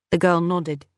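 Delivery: plain reading voice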